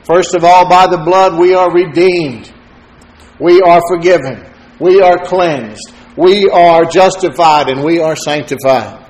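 loud, punched preaching list. Acted. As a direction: hammer each blessing home. Listing what the blood gives.

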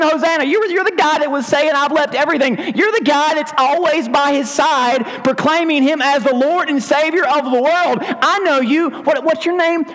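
put-on high, insistent girl's voice; a lightly voiced girl pointing him out; accusing servant girl. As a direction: press him like a nosy teenager.

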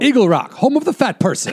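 high, hammed-up booster voice; mock-proud slogan announcer; mock town slogan. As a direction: proclaim it high and loud like a joke town motto.

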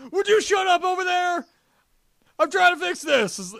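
high, exasperated mock yell; losing his cool. Retelling the movie's panel scene, losing his cool at a crewmate. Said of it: "Jason losing his cool with Bulk".

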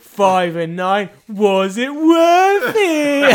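slow, high, loud bingo call; drawn-out cheeky caller voice. Retold or imitated. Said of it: "So it's like".

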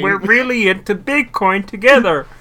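high, loud puppet-frog voice; sing-song like a felt frog puppet; Kermit the Frog voice. Pretend. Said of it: "voice of Kermit the Frog".

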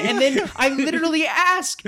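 ordinary talk, nothing marked

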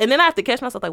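ordinary talk

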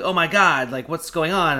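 a mom hearing screams from downstairs, alarmed and loud. Retold or imitated. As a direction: burst in like a frightened mom. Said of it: "my mom's like".

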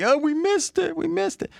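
high-pitched whiny mimicry of latecomers; mock lament. Host mimics viewers who didn't subscribe, high mock lament. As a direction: put on a squeaky, regretful voice.

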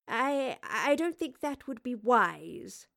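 slow, halting and reluctant; stall, then gently discourage; measured, doubtful reply; hesitant refusal. Acted. Professor hesitantly refuses Astrid's offer.

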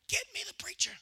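faint unvoiced whisper of a man just revived; weak whisper. Revived grandfather begging for the preacher, whispered. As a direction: breathe the words out barely voiced. Hoses pulled from his mouth.

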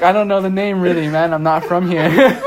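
high, loud clueless tourist plea; hammed-up dumb American act; playing dumb American. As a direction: act helplessly lost, half laughing. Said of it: "a dumb American disguise".

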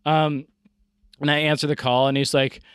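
ordinary delivery

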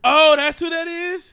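loud, high, drawn-out surprise; big amazed outburst; astonished realization. Real, not acted. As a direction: exclaim it as it suddenly clicks. Sudden realization about the character.

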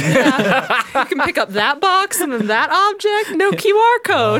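high, loud laughing robot. Pretend. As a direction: giggle through a robot impression.

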